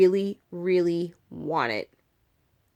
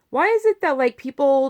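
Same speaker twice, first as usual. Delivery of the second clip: indignant; 7 semitones higher, 6 dB louder than usual. Real, not acted. Ranting about donors.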